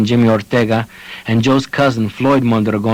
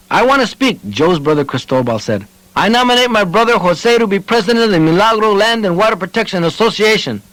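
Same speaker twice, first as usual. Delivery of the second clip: higher eager voice; eager brother; 8 semitones higher than usual. A narrator's reading. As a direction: put your brother forward. Cristobal nominates his brother.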